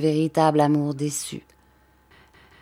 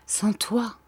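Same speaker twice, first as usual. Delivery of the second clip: two soft sighing syllables, softer; sighed; 4 semitones higher, 4 dB quieter than usual. A narrator's reading. Countess sighing into his ear, softer. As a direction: breathe the words out like two sighs. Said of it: "comme deux soupirs".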